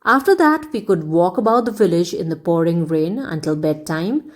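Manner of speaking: ordinary delivery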